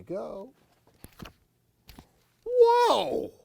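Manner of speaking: very high loud whoa; wow. Real, not acted. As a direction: marvel aloud. Tree monster reveal.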